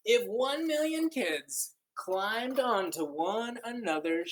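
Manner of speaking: slow, lilting picture-book reading; read-aloud storytelling. Acted. Teacher reading a counting book to children, lilting and slow. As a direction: read it like a wondrous tale to kids.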